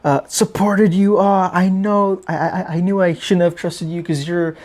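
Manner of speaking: lightly mock the betrayed followers; mock disappointed fan. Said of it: "People were just like".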